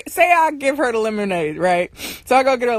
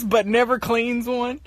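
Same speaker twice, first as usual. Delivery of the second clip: ordinary talk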